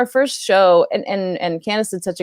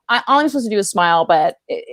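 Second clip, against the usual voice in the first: normal talking voice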